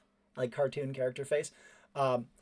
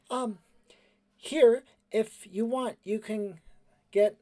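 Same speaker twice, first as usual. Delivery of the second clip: high, halting, sheepish offer; hesitant boy character; 8 semitones higher, 4 dB louder, slower than usual. Acted. Cleven guiltily trotting over to Totoi, hesitant boyish voice.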